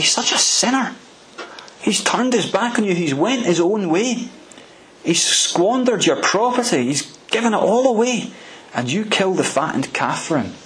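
high-pitched aggrieved protest, rising in pitch as it goes; a resentful son listing every grievance; older son protesting to his father, mocking and aggrieved; indignant complaint. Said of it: "the older son says to father".

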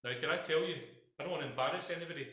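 ordinary talk, nothing marked

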